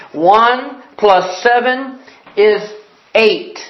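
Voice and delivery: slow, spaced-out correction with a pause before the answer; teacher correcting. Acted. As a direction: spell the sum out patiently for a pupil. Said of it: "Oh, and she says".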